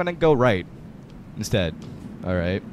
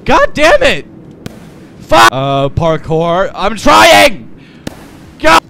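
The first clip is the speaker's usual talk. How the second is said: high, loud angry shouts; frustrated yelling; 5 semitones higher, 7 dB louder, slower than usual. Real, not acted. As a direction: rage at the controls. Frustrated climbing.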